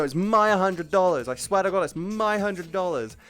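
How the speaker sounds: high, loud mock pleading insistence; insistent Karen. Karen insisting the fake bill is hers, high and loud. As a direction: whine it like a desperate Karen. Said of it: "Then I go".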